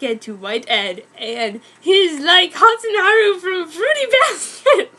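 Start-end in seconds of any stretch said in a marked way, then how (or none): 1.8-4.9 high, loud cracking laughter; laughing. Real, not acted. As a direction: break up laughing mid-sentence. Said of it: "laughing aside".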